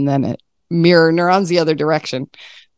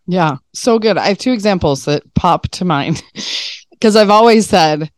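ordinary delivery

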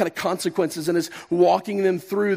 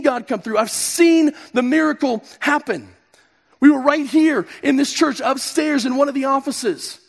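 full-force preaching; 7 semitones higher, 5 dB louder than usual. Acted.

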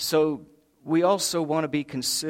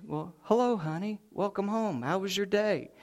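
much softer, sugary and higher; wife in bed, greeting husband with mock sweetness; innocent sweetness; 5 semitones higher, 7 dB quieter than usual.

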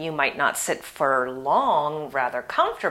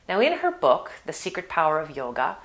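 normal talking voice

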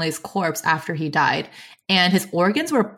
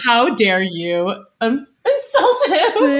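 mock outrage; 4 semitones higher, slower than usual.

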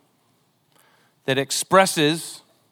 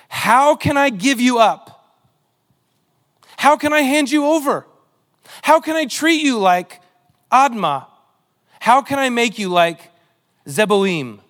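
impassioned scripture reading; 6 semitones higher, 4 dB louder than usual. Acted. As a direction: plead each question as God. Reads God's plea from Hosea.

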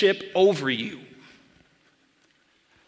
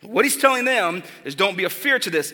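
ordinary talk

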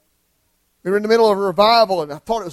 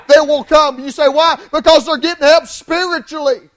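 high, loud, building sermon climax; full-force preaching; 5 semitones higher, 7 dB louder than usual. Acted. Preacher urging the church to keep praying, loud and high.

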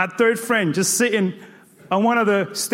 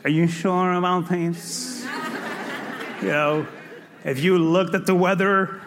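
low, slow, hesitant questioning; doubting friend Fear; 5 semitones lower, 4 dB quieter, slower than usual. Acted. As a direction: drag out each cautious worry. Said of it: "fear is that, is that friend".

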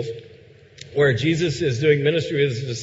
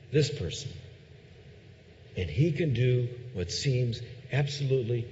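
softer, earnest sermon voice; intimate quiet preaching; 4 semitones lower, 8 dB quieter than usual. Acted. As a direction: draw the room in close and sincere.

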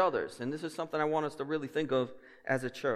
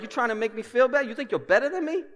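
louder, higher hammed-up defensive retort; mock defensive Bostonian; 8 semitones higher, 6 dB louder than usual. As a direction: play the touchy Bostonian taking offence. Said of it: "They'll be like".

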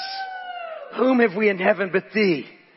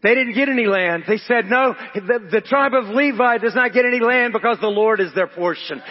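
raised, emphatic pulpit voice; forceful preaching; 6 semitones higher, 5 dB louder than usual. Real, not acted. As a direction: preach it out to the congregation.

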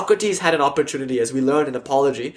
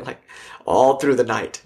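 usual speaking voice

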